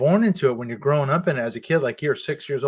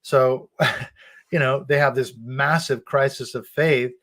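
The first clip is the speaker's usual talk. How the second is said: normal talking voice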